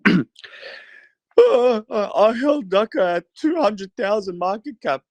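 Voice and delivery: high, slow mock influencer, hammed up; high mock scam influencer voice. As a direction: play a scammy promoter luring followers. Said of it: "or my scam influencer voice".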